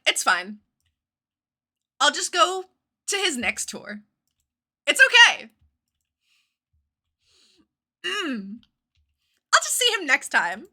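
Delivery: high, loud forced reassurance; forced cheerful. Retold or imitated. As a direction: brightly pretend it's all fine. Retold fake acceptance.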